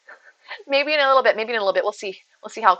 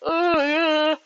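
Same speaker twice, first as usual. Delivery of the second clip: high yawn spilling into a word; imitated yawn; 7 semitones higher than usual. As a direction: yawn openly while pretending to listen. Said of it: "why are you yawning".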